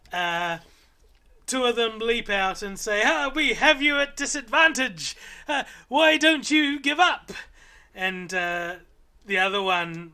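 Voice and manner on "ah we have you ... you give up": higher, louder squeaky bravado; owlet threat. Acted. Little owlets leaping out to scare the party, tiny squeaky bravado. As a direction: puff up like a tiny fighter.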